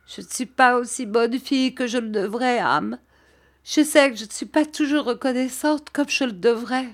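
put-on high, tearful young woman's voice; tearful cry. A narrator's reading. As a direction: plead it high, close to tears. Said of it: "s'écria-t-elle, les yeux pleins de larmes".

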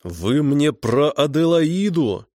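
high, slow, outraged shout; yelling. Acted. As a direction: shout it into the phone, slowly. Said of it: "завопил Петрович в трубку".

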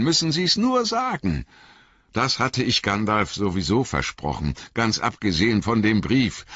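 lightly voiced garrulous innkeeper, rambling; a talkative landlord spinning his tale; chatty innkeeper. A narrator's reading. Innkeeper rambling on to Frodo, lightly voiced.

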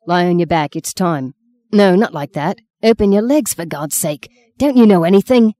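rising to a loud, exasperated demand; impatient husband. Acted.

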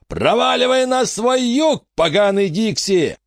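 hammed-up threatening shout; menacing yell. A narrator's reading. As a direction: roar it right in the old man's face. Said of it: "грозно заорал ему в лицо".

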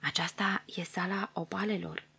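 breathy hushed whisper; hushed whisper of the dwarf guide. Acted. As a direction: whisper it in awe. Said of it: "Sispo șopti".

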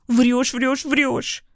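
high, rapid-fire hysterics; hysterical accusation. A narrator's reading. Hysterical woman repeats accusation. (истерично, высоким голосом)

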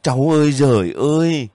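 wailing cry. A narrator's reading. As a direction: cry out to heaven in grief. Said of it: "anh nghe tiếng bác kêu". (kêu than thảm thiết, to và cao)

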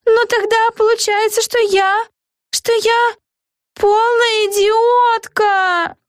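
very high, hammed-up wail; dawning realization building to a tearful wail. A narrator's reading. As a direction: end in a long, drawn-out mock cry. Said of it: "завопила Эгги Хуф".

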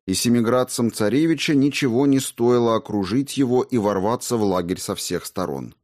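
usual speaking voice

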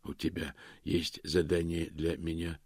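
soft, hushed stone monotone. A narrator's reading. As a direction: ask quietly, without feeling. Сказали останки Ангхаммарада. (тихий каменный монотон)

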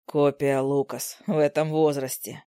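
low fond husband. Acted. Husband comments on baby son.

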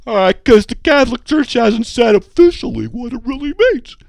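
loud, high put-on voice; hammed-up mock protest at high volume; mocking imitation.

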